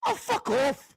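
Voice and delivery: high-pitched imitation of a comic; Robin Williams. Repeating a comedian's line for laughs, high mimicry. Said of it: "Robin Williams, live in the".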